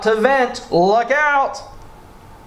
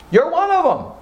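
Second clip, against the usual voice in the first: teasing jab; 12 semitones higher, faster than usual. Real, not acted. Teasing older listeners.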